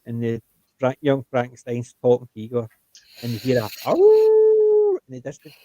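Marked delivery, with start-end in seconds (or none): 3.9-5.0 long high howl; wolf howl. Retold or imitated. Imitating distant howl.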